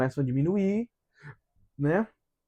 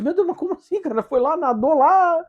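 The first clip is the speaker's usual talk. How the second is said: amazed disbelief; 10 semitones higher, 5 dB louder than usual. Retold or imitated. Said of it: "Aí ele falou assim".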